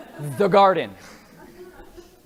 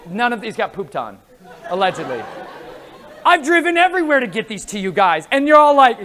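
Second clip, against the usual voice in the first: ordinary talk, nothing marked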